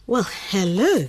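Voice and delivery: ordinary talk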